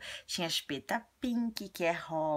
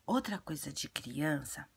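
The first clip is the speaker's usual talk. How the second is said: ordinary talk, nothing marked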